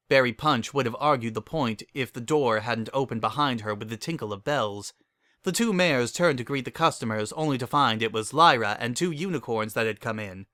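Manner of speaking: ordinary delivery, nothing marked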